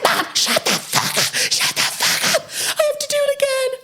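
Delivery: high-pitched mock outburst, half hissed; hammed-up teen snapping at her mum; squeaky staged fury at family; mock hysterical yell at mom. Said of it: "she's like".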